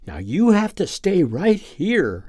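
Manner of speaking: high, gentle scolding of a pet; a girl tenderly scolding the puppy to stay put; loving scold. Acted. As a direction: wag a finger at the puppy, tenderly. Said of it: "lovingly scolds him".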